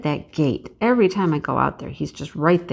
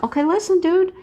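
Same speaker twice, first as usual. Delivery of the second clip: exasperated warning; 11 semitones higher than usual. Retold or imitated. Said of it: "I'm like".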